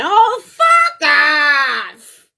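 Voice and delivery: loud, slow shout of fuck off; shouted quote. Retold or imitated. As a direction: bark it out like your friend telling you off. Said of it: "he went".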